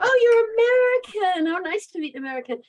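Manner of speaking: high, loud mock-gushing exclamation; imitated gushing greeting. Imitating Brits meeting her and guessing wrong, gushing. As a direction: gush it as an impression of a delighted stranger.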